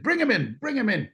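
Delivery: high, urgent calling out. Retold or imitated. Jag boss calling the driver in, urgent and high. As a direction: call them in fast, boss barking orders.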